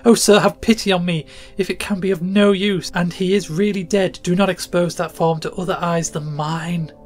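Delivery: high, loud pleading for pity. A narrator's reading. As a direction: beg him to spare her. Mother begs surgeon for pity.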